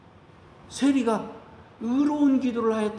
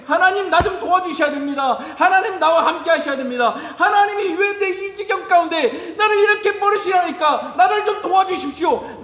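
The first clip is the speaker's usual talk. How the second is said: loud fervent plea to God, higher; crying out; 5 semitones higher, 4 dB louder than usual. Pretend. Pastor voices believer crying out to God, fervent loud plea. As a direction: call out desperately to God. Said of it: "라고 부르짖고".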